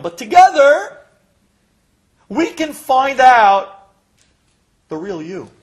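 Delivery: loud, high, stretched-out promise; mock a rousing therapist, slow and booming; mimicked doctor's pep talk. Patient quoting the doctor's promise, mock rousing therapist. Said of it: "you said to me".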